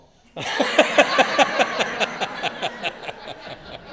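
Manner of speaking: a loud, long burst of laughter, falling; big burst of laughter. Real, not acted. Teacher laughs at his own story about Dale, a loud burst of laughter.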